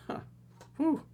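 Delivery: usual speaking voice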